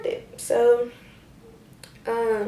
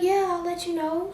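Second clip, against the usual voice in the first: sweet brush-off; 9 semitones higher than usual. Retold or imitated. Placating mom.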